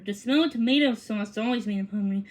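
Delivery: plain reading voice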